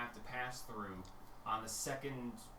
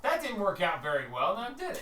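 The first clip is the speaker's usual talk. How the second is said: loud mock quote, rising much higher; playful, raised-voice imitation; mock exclamation; 6 semitones higher, 6 dB louder than usual. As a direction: call it out in pretend protest. GM voicing a mock-quote, laughter follows.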